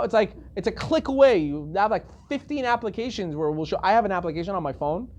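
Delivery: usual speaking voice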